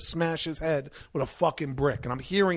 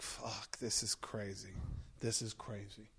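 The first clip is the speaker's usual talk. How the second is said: lower, much softer; stunned mutter; 6 semitones lower, 15 dB quieter, slower than usual. Retold or imitated. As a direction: mumble to yourself in shock. Exhausted, trudging back.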